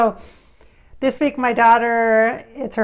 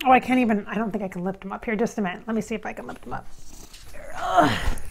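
ordinary talk, nothing marked

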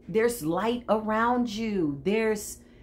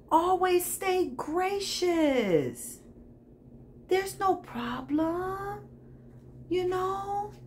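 high, very slow, sugary reassurance; sweetly gracious; 9 semitones higher, 3 dB quieter, much slower than usual. Pretend. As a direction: stretch it out in mock sweetness. Mock-gracious reassurance to the rivals.

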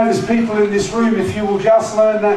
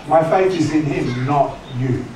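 ordinary talk

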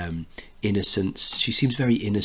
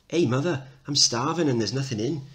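put-on higher, a bit cheeky kid; higher hungry child voice; 6 semitones higher than usual. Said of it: "Kid three off".

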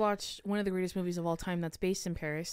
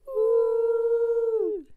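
high loud whoop; cheering woo; 9 dB louder than usual. Acted. Cheering show opening.

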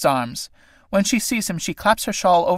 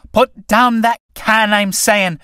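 slow, loud and higher, a menacing order; slow loud threat with hammer raised; threatening; 7 semitones higher, 6 dB louder, much slower than usual. Acted. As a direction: lean on every word like a raised hammer. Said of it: "picking up the hammer threateningly".

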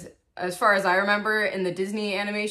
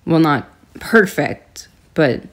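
dramatic confessional monologue; 5 semitones lower, 6 dB louder than usual. Acted. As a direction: deliver it like a moody film narration. Mock-diary monologue continues.

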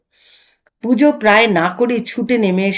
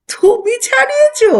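much higher, louder scoffing question; incredulous; 5 dB louder than usual. Acted. Husband mocking wife's claim she cured him, incredulous. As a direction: scoff at her boast in disbelief.

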